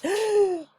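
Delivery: loud, high intake of breath; exaggerated chest-heaving gasp; demonstrated gasp. Shown as a demonstration. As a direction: show a bad, sharp breath in. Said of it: "gasp our chests up".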